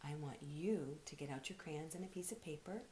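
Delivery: ordinary delivery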